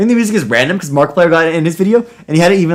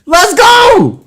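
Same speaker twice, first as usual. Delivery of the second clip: high, hyped-up shout; excited cheer; 4 dB louder than usual. Real, not acted. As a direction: whoop it high in triumph. Cheering the absurd story turn.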